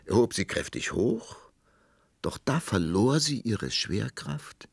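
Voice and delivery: plain reading voice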